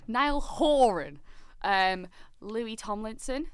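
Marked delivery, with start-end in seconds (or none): 0.1-1.2 slow, loud, high stress on "Horan"; emphatic name. Real, not acted. Cohost settling how to say [name]'s surname, emphatic "[name]". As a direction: draw out "Horan" loudly and pointedly.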